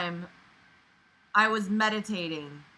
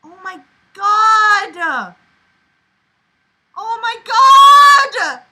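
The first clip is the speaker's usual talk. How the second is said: much higher, louder and slow; outraged yell; 10 semitones higher, 13 dB louder, much slower than usual. Real, not acted. Reader yelling at the story's narrator in outrage.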